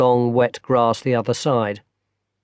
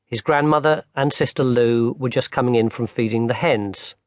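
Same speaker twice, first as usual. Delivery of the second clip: ordinary delivery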